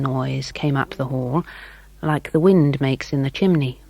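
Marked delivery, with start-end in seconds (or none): none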